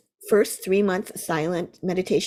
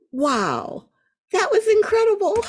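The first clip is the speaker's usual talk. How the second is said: rising to a very high, loud burst on incredible; reliving the thrill of the insight; amazed wow; 4 dB louder than usual. Retold or imitated. Retold moment of awe.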